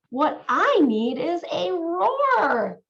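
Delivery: slow, drawn-out discovery in a mouse voice; mouse's bright idea. A narrator's reading. Mouse in bed realising he needs a roar, slow and wondering. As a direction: savour the idea like a small mouse. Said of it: "he said".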